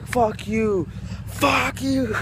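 high mock yell, rising on the repeat; imitated yelling. Vlogger retelling a lady yelling at dogs, high mock yelling. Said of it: "yelling at the dogs".

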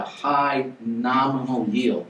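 "nominal" set apart and emphasized; stress on NOMINAL. Real, not acted. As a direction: lean on the word "nominal". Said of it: "with emphasis on the word nominal".

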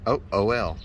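ordinary delivery

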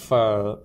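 "phở" with a long, blown f; extra-breathy f. Shown as a demonstration. Teacher shows English f, "phở" with extra air. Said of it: "thổi hơi ra nhiều hơn một tí".

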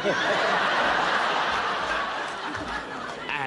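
long airy breathless laugh; helpless laughter. Real, not acted. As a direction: wheeze with laughter. Host laughs at pyjamas story.

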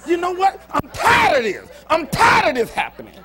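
high, loud fed-up shout; acted angry shout. Believer telling the devil off, furious shout. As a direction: yell it like you're done with it. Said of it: "just say".

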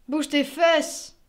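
loud, slow command to get moving; sharp order. Acted. Younger self ordering his grown-up self to move, loud. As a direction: bark it out like a kick in the pants.

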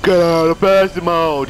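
high, loud mocking imitation; mocking shout. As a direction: shout it back like a whiny player. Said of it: "I don't go".

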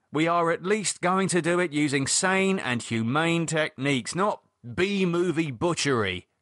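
high, emphatic, exasperated warning; David insisting. Acted. David telling Lydia the creature is real, emphatic. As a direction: lay down the rules firmly.

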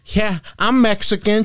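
talkative Mexican woman. Retold or imitated. Woman talking to the whole section, imitated high voice. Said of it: "there was a Mexican woman".

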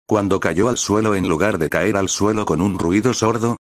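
ordinary delivery, nothing marked

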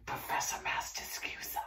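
breathy whispered aside; mock whisper. Teacher jokingly whispering her own grand title to the class.

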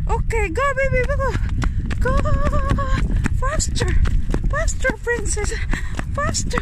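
loud, very high, rapid-fire cheering; excited urging. Real, not acted. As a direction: shout the dog on in a race. Racing with the dog.

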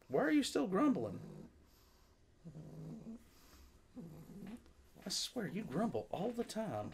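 pet talk. Real, not acted. Higher pet talk to dog.

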